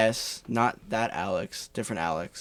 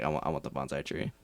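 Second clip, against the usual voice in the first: low, soft, flat insistence; deadpan demand; 9 semitones lower, 10 dB quieter than usual. Retold or imitated. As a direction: say it dead serious and quiet. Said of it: "I was like".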